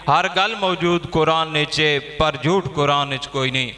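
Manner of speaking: poem recitation. Acted. Poet recites verse at gathering.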